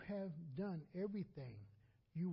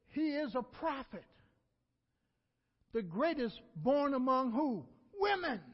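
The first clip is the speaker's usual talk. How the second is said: loud, higher preaching build climbing to a high shout on "Women"; 6 semitones higher, 9 dB louder than usual. Acted. Preacher quoting Jesus to the congregation, in a rising shout. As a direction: lift it to a ringing call.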